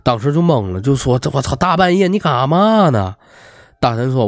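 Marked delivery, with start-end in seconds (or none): 1.2-3.1 high-pitched, mildly exasperated question; startled protest. A narrator's reading. Woken roommate to the student wailing at midnight, startled protest. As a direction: play a roommate taken aback.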